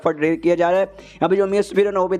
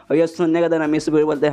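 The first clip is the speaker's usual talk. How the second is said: ordinary delivery, nothing marked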